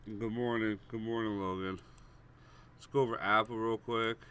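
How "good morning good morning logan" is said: ordinary delivery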